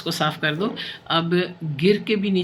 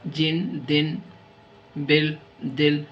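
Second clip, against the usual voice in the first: slow dictation; much slower than usual. Shown as a demonstration. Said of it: "dictating words".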